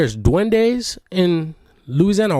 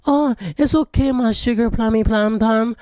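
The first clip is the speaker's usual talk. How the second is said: put-on sweet girl voice; 6 semitones higher than usual. Girl with a crush comforts him.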